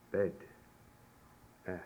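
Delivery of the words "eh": "Eh" as the e in bed; eh vowel. Shown as a demonstration. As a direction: sound out a short, isolated "Eh". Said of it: "it's an eh sound".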